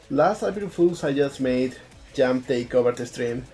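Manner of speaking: normal talking voice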